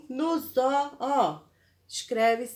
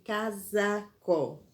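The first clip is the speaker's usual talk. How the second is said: casaco slowly, syllable by syllable; slow dictation; slower than usual. Shown as a demonstration. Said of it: "Olha a escrita com S".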